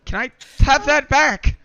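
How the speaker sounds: high, loud soldier voice; flustered soldier voice. Acted. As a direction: ask high and loud in character.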